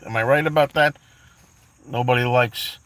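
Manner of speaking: ordinary talk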